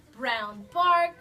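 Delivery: plain reading voice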